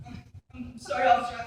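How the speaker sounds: badly faked sob to win sympathy; fake crying. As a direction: pretend to cry while apologising. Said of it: "start crying".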